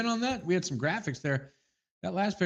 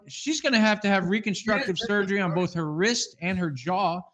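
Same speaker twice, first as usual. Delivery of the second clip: ordinary delivery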